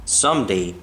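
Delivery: plain reading voice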